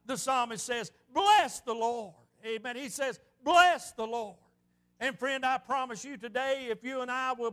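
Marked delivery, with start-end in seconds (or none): 1.1-2.1 slow, emphatic "Bless the Lord"; exclaimed praise. Retold or imitated. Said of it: "a whole new tone about his voice". 3.4-4.4 slow, emphatic "Bless the Lord"; exclaimed praise. Retold or imitated. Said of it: "a whole new tone about his voice".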